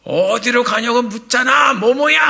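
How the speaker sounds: loud, high, slow and testy retort; irritable snap back. Retold or imitated. Clerk snapping back at the young man, irritable yell. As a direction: snap back at him in a temper. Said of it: "아주 신경질적으로 딱 얘기를 하니까".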